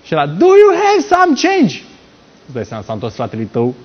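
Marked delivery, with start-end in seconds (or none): 0.2-1.8 high, drawn-out street holler; long, piercing mock megaphone call; imitated beggar's yell. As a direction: wail it slowly at full pitch. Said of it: "și a urlat".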